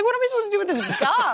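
high, fast mock panic; retold panic with a laugh in it; freaking out. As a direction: squeal it quickly, laughing at your own panic. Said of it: "I'm freaking out".

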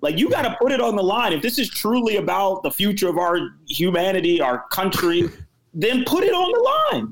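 rally them, pitch climbing with conviction; fired-up rant. Real, not acted. Heated rant about acting on words.

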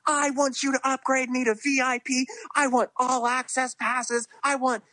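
high-pitched mock entitled demands; demanding festival-goer. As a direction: imitate someone pestering staff for upgrades. Imitates people hounding festival staff.

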